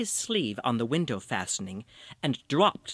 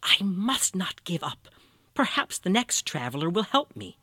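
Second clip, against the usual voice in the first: hint of a whisper, higher old woman; lightly voiced old woman, murmured to herself; 5 semitones higher than usual. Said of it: "the old woman whispered to herself".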